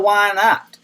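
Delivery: ordinary talk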